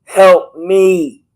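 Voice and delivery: high, loud mock scream; high loud plea for help. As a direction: cry out for help at the top of your voice. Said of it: "you feel the need to scream".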